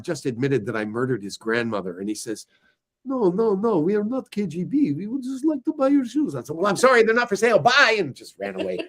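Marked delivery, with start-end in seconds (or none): 3.0-6.3 high put-on voice insisting; imitating Russian buyer. 6.6-8.0 fast, high brush-off before running; hurried refusal. Retold or imitated. Teen refuses and runs.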